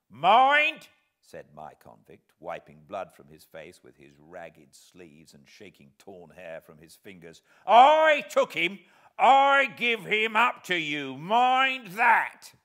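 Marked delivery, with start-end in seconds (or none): 0.1-0.9 forceful shout. Acted. Bleeding convict demands attention. 7.7-12.5 loud, slow, high rough voice; gruff insistence. Acted. Convict insists fiercely.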